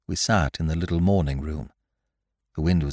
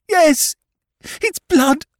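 loud, very high, frantic shrieking; woman in full hysterics; hysterical confession; 4 dB louder than usual. Acted. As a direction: scream it out in terror, building to desperate pleas. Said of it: "she cried hysterically".

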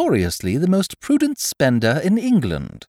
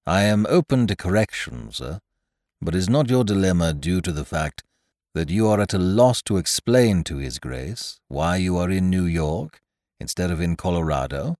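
Jeeves the butler; 7 semitones lower than usual. A narrator's reading. Butler answering his master, low and deferential.

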